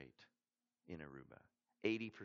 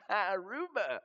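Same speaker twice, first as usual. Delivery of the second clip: high, loud, laughing delight; gleeful laugh; 11 semitones higher, 9 dB louder, slower than usual. Retold or imitated. Bragging to friends sent to Asia, laughing. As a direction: savour the word like a holiday prize. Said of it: "And I'm like".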